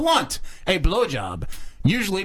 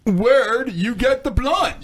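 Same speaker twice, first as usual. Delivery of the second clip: slow, lazy stoner drawl; 4 semitones higher, much slower than usual. Pretend. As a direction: drag it out like a stoned buddy. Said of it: "I'll be Tom".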